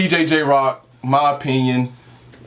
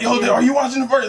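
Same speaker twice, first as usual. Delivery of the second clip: excited; 9 semitones higher, faster than usual. Retold or imitated. Excited call to friend.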